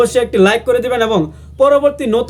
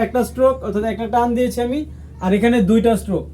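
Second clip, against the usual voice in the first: ordinary delivery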